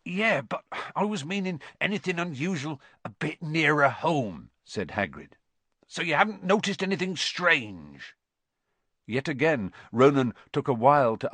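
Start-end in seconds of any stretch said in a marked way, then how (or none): 0.0-4.5 higher-pitched character voice for a probing question; dropping back down at the end; Hagrid. A narrator's reading. Narrated Hagrid questioning the centaur. 5.9-8.1 high, questioning character voice; in-character query, lifted above the narration; Hagrid. A narrator's reading.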